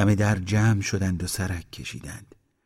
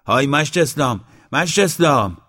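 high, raised hailing call; loud shout; 6 semitones higher, 6 dB louder than usual. A narrator's reading. Man alone on the porch hails the musician, shouting. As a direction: holler for him from a distance. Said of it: "با صدای بلند داد زد".

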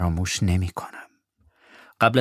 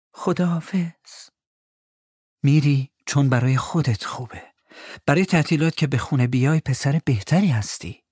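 sad goodbye; 5 semitones higher than usual. Acted. Mother's sad farewell.

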